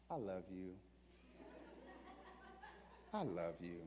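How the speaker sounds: hushed, gentle I love you; soft loving reply. Retold or imitated. Preacher to people cursing him, softly loving. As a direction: answer the insults with soft love.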